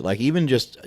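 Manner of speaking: ordinary talk, nothing marked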